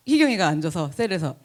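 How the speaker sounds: ordinary delivery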